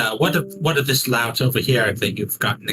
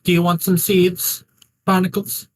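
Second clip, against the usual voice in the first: high, sing-song pet talk; coaxing a parrot; 5 semitones higher than usual. Acted. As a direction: coo at the bird to win it over. Talking sweetly to a parrot.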